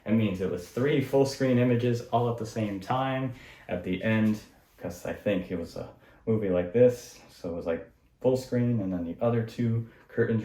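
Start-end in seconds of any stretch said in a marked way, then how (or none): none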